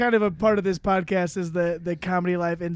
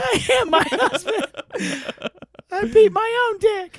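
high squeaky protest, building louder; mock emasculated outrage; 13 semitones higher, 4 dB louder than usual. Host plays man taken for wife, squeaky outrage. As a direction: declare it indignantly in a very high put-on voice. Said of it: "It's so emasculating".

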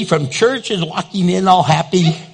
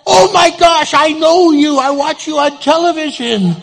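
excited fan; 9 semitones higher than usual. Retold or imitated. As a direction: gush as a starstruck fan. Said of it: "a car comes".

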